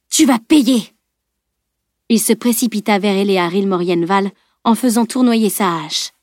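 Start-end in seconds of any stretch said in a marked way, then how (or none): 0.1-0.9 raised, vengeful shout; furious threat. A narrator's reading. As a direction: shout a vow of revenge. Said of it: "vociféra Bjorn". (menace criée avec rage)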